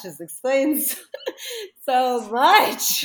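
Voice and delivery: high, loud burst through laughter; amazed, laughing exclamation. Retold or imitated. Recalling human design blowing her mind, laughing amazement. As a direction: gush it and break up laughing.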